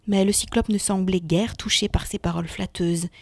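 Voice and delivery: ordinary delivery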